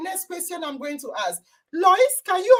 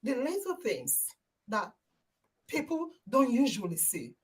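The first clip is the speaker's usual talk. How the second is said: soft, low and halting; hushed reflection; 5 semitones lower, 12 dB quieter than usual. Real, not acted. Host taking in what Lois said, softly reflective. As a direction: ponder it quietly, almost to yourself. Pondering the answer in awe.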